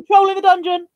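high, loud panicked shout; mock alarm shout. Quoting the film alarm to the chat, hammed-up shout. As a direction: burst in shouting the warning.

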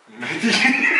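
laughing at own slip. Real, not acted. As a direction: crack up at the mistake.

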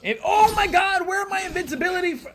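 high panicked yelling; panicked shout. Real, not acted. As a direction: scream in panic. Panic as enemies swarm.

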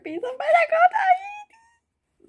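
high, loud excited shriek. Real, not acted. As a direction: squeal it out.

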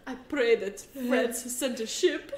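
put-on hammy, slow French accent; badly faked French accent. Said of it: "I can't do a French accent".

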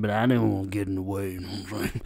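ordinary talk

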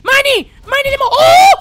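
high, very loud joyful shriek; ecstatic scream. Real, not acted. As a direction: scream like you hit the jackpot. Finds money, screams.

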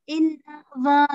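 Innover as IN-nuh-vuh; schwa in the middle. Shown as a demonstration. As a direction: model Innover with neutral uh sounds. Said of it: "mà là âm ơ".

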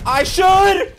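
high, loud shouted reply. Real, not acted. Answering a teammate telling him to come down, loud shout. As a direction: yell it back emphatically.

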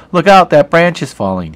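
a teacher acting out the warning in a full sentence. Shown as a demonstration. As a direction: call the whole warning out. Teacher acts out the quote.

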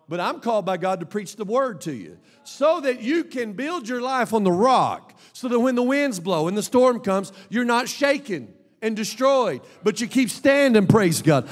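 rousing sermon climax; full-force preaching. Real, not acted. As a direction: preach it hard, pitch up and punching key words.

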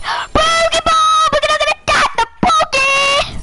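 high-pitched excited call; gamer's high attack cry; excited battle cry. Real, not acted. Calls out an attack in game.

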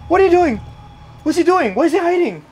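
high, loud panicked calls; alarmed yelling at the hiding dog; frantic high shouts. Real, not acted.

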